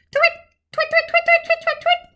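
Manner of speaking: high "twit" over and over; repeated owl calls. A narrator's reading. Olive calling again and again, high owl calls. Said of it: "carried on twitting".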